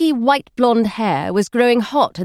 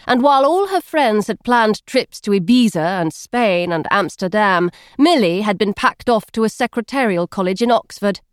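plain reading voice

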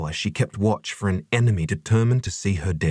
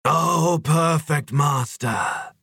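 high, slow, drawn-out adoration; rapturous sigh; 7 semitones higher, much slower than usual. A narrator's reading. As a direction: sigh it out in worship. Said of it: "sighed the other eleven Huelum".

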